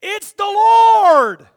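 high, very loud, drawn-out cry; acted proclamation. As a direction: stretch LORD into a long shout. Acts Eli's revelation to Samuel.

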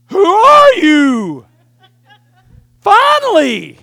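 very loud, high, drawn-out outburst; hammed-up teenage amazement at full volume; astonished mock shout. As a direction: holler in exaggerated disbelief and relief. Teen's astonished reply to normal dad.

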